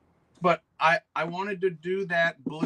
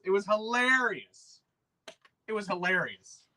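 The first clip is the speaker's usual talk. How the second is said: "It was hilarious" stretched slow and relishing; drawn-out delight; 6 semitones higher, slower than usual. Real, not acted. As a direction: savour every word of "hilarious". Repeats the phrase, savouring it.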